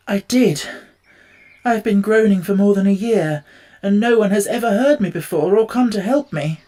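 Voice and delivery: a lonely man finally heard; mournful Tin Man begging for help; sad voice. A narrator's reading. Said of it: "moved by the sad voice in which the man spoke".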